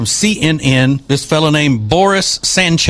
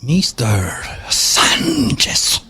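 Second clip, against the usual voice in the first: slow mocking drawl; much slower than usual.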